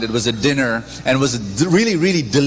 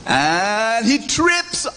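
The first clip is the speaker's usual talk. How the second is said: hammed-up fall; 12 semitones higher, much slower than usual. Re-dramatized fall in field.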